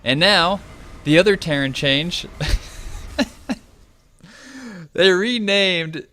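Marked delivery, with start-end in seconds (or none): 2.3-3.5 real, breathy stifled laugh; amused laugh. Real, not acted. Caster tickled by a unit change, amused laugh. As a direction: laugh under your breath.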